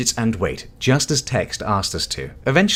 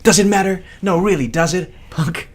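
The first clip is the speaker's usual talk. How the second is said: heated retake; 9 semitones higher than usual. Shown as a demonstration. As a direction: throw it back at her hard. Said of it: "Where are we in what I'm gonna call the script?".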